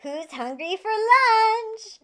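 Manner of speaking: a high, loud, slow call across the house; a bright slow mom's call. Acted. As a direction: call the kids in for lunch. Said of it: "Madison's mother called out".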